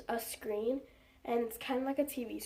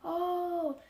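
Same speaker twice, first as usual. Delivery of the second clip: surprised; 7 semitones higher than usual. Retold or imitated. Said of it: "She's like".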